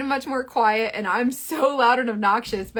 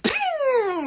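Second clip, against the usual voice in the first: high ping. Retold or imitated. Coffee jolt.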